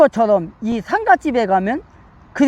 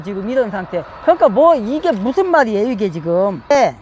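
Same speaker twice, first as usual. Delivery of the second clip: ordinary talk, nothing marked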